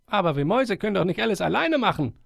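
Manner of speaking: put-on young mouse. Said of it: "sagte eine junge Maus".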